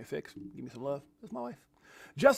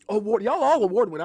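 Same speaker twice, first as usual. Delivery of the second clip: booming announcer call; 4 semitones higher, 6 dB louder than usual. Shown as a demonstration. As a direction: shout it out loud, hype announcer. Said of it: "I should start saying that".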